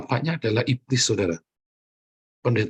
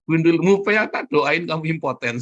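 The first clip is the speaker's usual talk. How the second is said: loud, higher scolding; angry retort; 4 semitones higher, 4 dB louder than usual. Real, not acted. Preacher rebuking the cheating man, loud. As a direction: snap back at the cheater.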